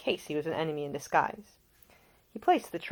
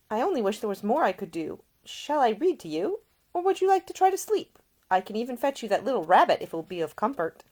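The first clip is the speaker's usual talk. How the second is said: higher, brighter-pitched caring lady; a young woman tending a seasick man, solicitous and higher; Ida, gentle young woman; 6 semitones higher, 4 dB louder than usual. A narrator's reading. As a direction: voice the attentive young lady nursing him. Ida speaking.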